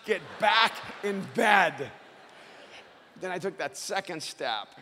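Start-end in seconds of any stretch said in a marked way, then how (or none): 0.1-1.9 mild mock yell, building to a louder finish; retold scream. His wounds ordering him back to bed, mock scream. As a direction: scold yourself, stretching out the final order. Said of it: "was screaming".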